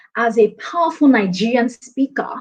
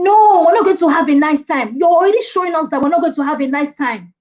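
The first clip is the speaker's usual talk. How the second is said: high, loud opening NO, then insistent; emphatic rebuttal; 5 semitones higher, 3 dB louder than usual. Shown as a demonstration. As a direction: shoot down the dull presenter with energy. Rebutting the low-energy presentation opener.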